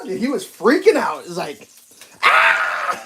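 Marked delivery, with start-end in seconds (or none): 2.2-3.0 mock panicked yell. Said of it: "he was going nuts".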